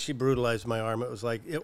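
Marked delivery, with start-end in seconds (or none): none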